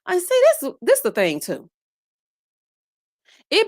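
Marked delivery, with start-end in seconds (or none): 0.0-1.7 high exclaim. Real, not acted.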